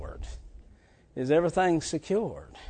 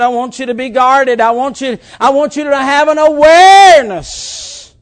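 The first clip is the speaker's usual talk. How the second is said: louder preacher's build on the Lord's words; preaching a quote; 8 semitones higher, 13 dB louder than usual. Acted. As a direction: lift the Lord's warning to the room. Quoting the Lord, preaching.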